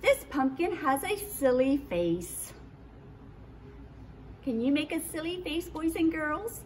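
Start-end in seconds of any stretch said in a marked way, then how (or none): 4.4-6.6 put-on quick, lively invitation to kids; kids show host. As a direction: coax the kids to join in. Invites children to make faces.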